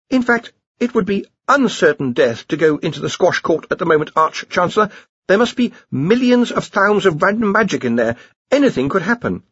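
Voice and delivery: high-pitched, anxious underling; nervous Ponder. A narrator's reading. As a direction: warn the boss in a worried high voice. Ponder protests to the Archchancellor.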